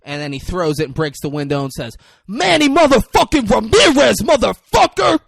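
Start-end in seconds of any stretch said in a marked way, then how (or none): 2.3-5.2 high, full-volume film shout; hammed-up triumphant scream; retold battle cry. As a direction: yell it while hurling a rock. Acting out window-breaking battle cry.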